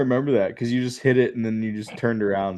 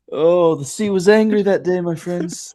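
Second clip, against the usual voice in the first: higher, theatrical line delivery; a theatrical quote tossed in, higher and grand; mock dramatic quote; 8 semitones higher than usual. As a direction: ham it up like a famous sitcom line.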